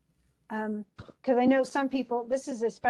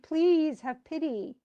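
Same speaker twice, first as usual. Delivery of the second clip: higher, drawn-out plea; pleading; 5 semitones higher, slower than usual. Acted. Daughter still pleads with her father for pity, pleading. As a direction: stretch it out, begging for mercy. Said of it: "And Miranda still pleads".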